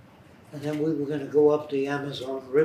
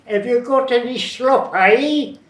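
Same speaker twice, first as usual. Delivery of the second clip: high, loud Cockney voice; loud high Cockney begging for slop; Cockney imitation; 9 semitones higher, 10 dB louder than usual. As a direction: really sell the Cockney dialect. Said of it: "I wanted to get that dialect real".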